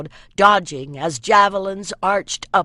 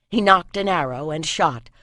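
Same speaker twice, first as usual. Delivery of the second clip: usual speaking voice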